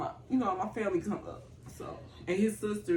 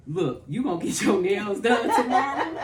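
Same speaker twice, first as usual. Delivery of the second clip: loud, laughing, higher bossy relative; laughing mock scold; 4 semitones higher, 8 dB louder than usual. Sister teasing her about her nails, loud and laughing. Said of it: "my sister mom would say".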